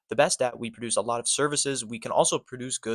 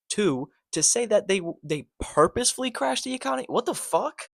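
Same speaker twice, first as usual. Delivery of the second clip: can't believe what he just heard; incredulous disbelief; 9 semitones higher than usual. Real, not acted. Reacting to a pundit's clip, incredulous high outburst. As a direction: voice jumps way up in disbelief.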